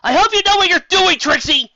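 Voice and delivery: very high, loud shout from the sky; calling down. Acted. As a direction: yell down to a friend far below. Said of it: "calling down".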